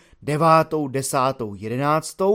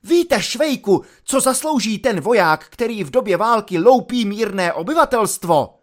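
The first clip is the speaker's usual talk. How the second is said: loud, high-pitched shouted rebuke; lieutenant exploding in a high, loud yell; angry outburst; 7 semitones higher, 6 dB louder than usual. A narrator's reading. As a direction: shout it at a subordinate in outrage. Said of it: "pak se rozkřikl".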